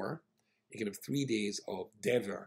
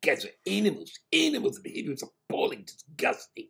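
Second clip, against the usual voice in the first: much higher and louder, outraged; indignant; 6 semitones higher, 8 dB louder than usual. Retold or imitated. Passenger complains to the rabbi about the noisy men, indignant. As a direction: spit out "animals" in disgust. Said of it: "She said".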